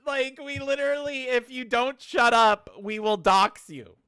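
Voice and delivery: worked-up mocking rant; excited mock. Real, not acted. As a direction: ham up the company's threat. Mocking the company's threat.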